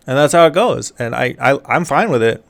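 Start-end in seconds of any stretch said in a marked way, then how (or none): none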